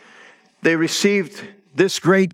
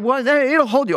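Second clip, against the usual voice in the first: higher, coaxing uncle voice; reassuring; 8 semitones higher than usual. Retold or imitated. Uncle coaxing his niece to try the rocker, higher voice. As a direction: coax her gently, pitched up. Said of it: "I said".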